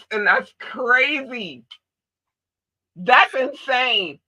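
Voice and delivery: slow, high disbelief; amazed disbelief. Real, not acted. Disbelief at accent comparison.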